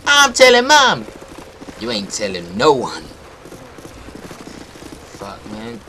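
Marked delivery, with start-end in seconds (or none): 0.1-1.1 high, drawn-out, loud tattle; tattling little sibling. Acted. Little sibling threatening the older one, high whiny tattle. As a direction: threaten to tell, stretching it out high.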